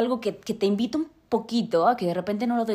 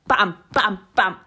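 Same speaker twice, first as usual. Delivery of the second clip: pam, pam, pam tapped out as little jumps; rhythmic pam sounds; 5 dB louder, much slower than usual. Shown as a demonstration. Explaining how the brain reads in jumps, rhythmic pam sounds. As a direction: beat out each pam like an eye jumping along a line. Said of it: "Eso es lo que hace como tu cerebro".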